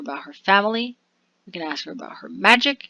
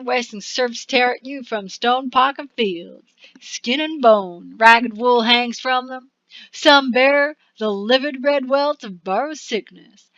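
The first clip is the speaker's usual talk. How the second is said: dramatic narration; 4 semitones higher, 5 dB louder than usual. Acted. Reads card narration aloud.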